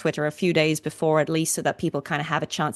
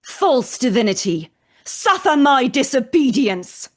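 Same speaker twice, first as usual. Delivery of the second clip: loud, higher furious defiance; defiant roar; 6 semitones higher, 7 dB louder, slower than usual. Acted. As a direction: build into a raging challenge. Live line read, defiant climax.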